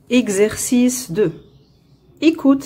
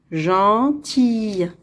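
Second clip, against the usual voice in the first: "Gentille" slowly in two parts; slow model reading. Shown as a demonstration. Teacher reading for pupils to hear the sound, slowly. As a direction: draw out both syllables of "Gentille". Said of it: "On va lire".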